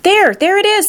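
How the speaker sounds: high excited call; shouted. A narrator's reading. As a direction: point it out eagerly. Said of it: "someone else shouted".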